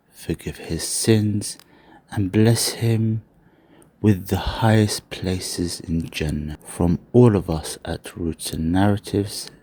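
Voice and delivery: slow, measured memorial message read to viewers; a grave, dignified sign-off for a late friend; solemn announcement. Acted. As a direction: read it like a formal tribute over music.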